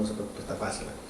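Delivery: fast reassuring whisper; whispered aside to calm the listeners. Real, not acted. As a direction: lean in and whisper it.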